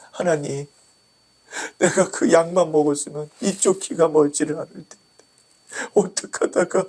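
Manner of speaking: high, crying plea to God; tearful lament. Acted. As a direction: wail a grieving question through acted tears. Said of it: "한없이 울었답니다".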